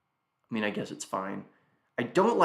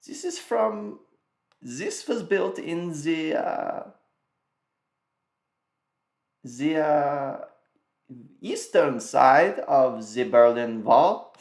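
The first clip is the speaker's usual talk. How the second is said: put-on German accent; 4 semitones higher, 3 dB louder, much slower than usual. Mock German joke continues.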